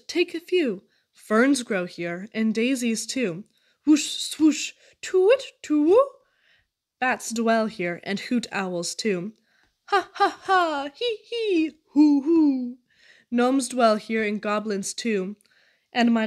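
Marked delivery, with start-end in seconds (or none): 3.9-6.1 wind and owl hoots. A narrator's reading. Spooky house sounds, wind and owl. 9.9-12.8 high, spooky ghost laugh, ha hee hoo; eerie cackle. A narrator's reading. Ghostly laughter in deserted house.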